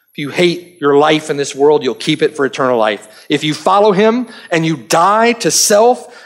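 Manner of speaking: ordinary talk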